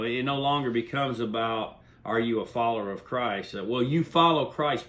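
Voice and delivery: ordinary talk, nothing marked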